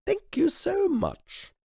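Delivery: high, slow gracious thanks; slow high royal thank you; queenly dismissal. Acted. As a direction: dismiss them politely and finally.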